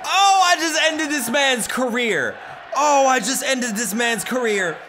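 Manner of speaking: high, excited whoop; hyped-up victory shout; gloating celebration. Real, not acted. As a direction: crow like you just landed a knockout. Celebrates own insult as knockout.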